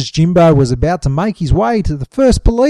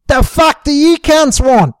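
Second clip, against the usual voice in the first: loud, high, slow yell from the back seat; 9 semitones higher, 5 dB louder, slower than usual. A narrator's reading. Sheila yelling at the men from the back seat. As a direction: bellow it at them, slowly. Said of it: "Sheila yelled".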